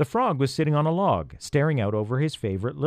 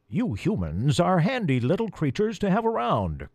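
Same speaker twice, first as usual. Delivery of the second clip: higher croaky crow voice; croaking; 7 semitones higher than usual. Acted. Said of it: "croaked Jackson with a wink".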